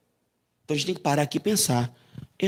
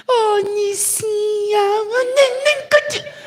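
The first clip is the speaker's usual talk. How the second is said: put-on very high, slow cooing baby talk; mock baby talk; 4 dB louder, much slower than usual. Preacher mocking a Spirit cooing to Nicinha in baby talk. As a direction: gush over her like a baby. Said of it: "Eu não imagino o Espírito Santo falando assim".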